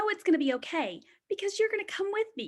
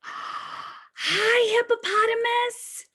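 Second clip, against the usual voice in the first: higher, gasping hello; delighted greeting; 7 semitones higher, 4 dB louder, slower than usual. Acted. Teacher greets the hippo picture for the kids, higher and louder. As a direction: gasp and greet it like a new friend.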